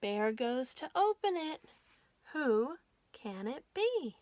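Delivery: plain reading voice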